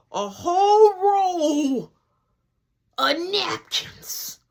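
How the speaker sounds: "a whole roll of napkins" stretched out very slowly; slow, high emphasis on "whole roll"; drawn-out disbelief. A narrator's reading. Teller dwells on wasted napkins.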